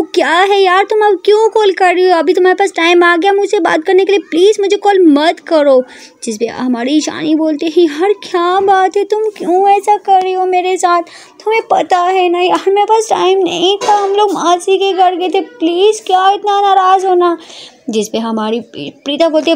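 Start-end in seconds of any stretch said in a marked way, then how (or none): 0.0-5.8 higher-pitched, irritated scolding; mildly angry. Acted. Prita answers Ishani's repeated calls, angry. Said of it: "गुस्से में बोलती है". 7.8-17.4 high, plaintive explaining; pleading Ishani. Acted. Ishani explains her absence to Prita, pleading.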